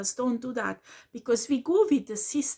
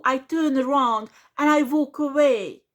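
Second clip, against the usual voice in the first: ordinary talk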